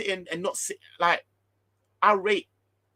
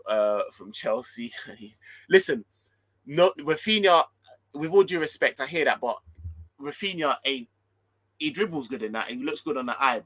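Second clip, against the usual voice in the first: ordinary talk, nothing marked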